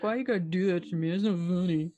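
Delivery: quiet, fast sulky whine; a hint of a sulking friend; pouty. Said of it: "he'd just be super pouty".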